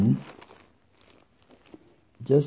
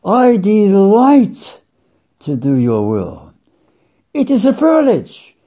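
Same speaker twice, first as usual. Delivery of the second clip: 「I delight」を高く大きく、ゆっくり歓喜して; rejoicing delight; 6 semitones higher, 3 dB louder than usual. Shown as a demonstration. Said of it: "rampant rejoicing, deliciousness".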